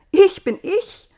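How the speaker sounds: very high, loud protest; indignant Eva. A narrator's reading. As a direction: insist on being herself.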